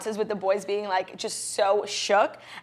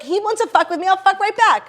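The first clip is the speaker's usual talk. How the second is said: defiant exclaim; 10 semitones higher, 8 dB louder than usual. Real, not acted. Defiant retort at the driver.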